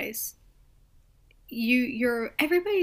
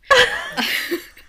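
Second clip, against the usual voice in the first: loud laugh; 12 dB louder than usual. Real, not acted. Loud burst after plea.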